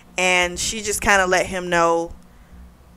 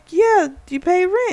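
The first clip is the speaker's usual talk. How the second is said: a pitched-up imitation of her; high mock voice; 11 semitones higher than usual. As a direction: quote her much higher. Retold argument, quoted in high voice.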